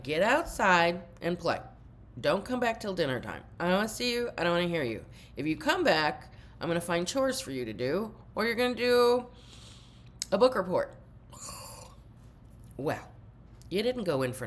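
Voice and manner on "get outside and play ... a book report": loud, higher mock parent yelling; imitating yelling parents. Parent yelling at kid to get outside. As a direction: bark it like an exasperated sixties parent. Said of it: "My parents were like".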